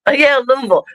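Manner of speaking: ordinary delivery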